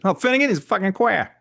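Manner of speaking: put-on high, jeering Boston accent; mock Boston taunt. What people said when he was a kid, mock Boston jeer. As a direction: imitate a local's sneer in Boston accent. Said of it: "that's what people said".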